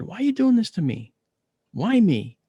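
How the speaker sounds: high-pitched, exasperated plea; pleading protest. Retold or imitated. Patient asking his kidnapper for a reason, pleading.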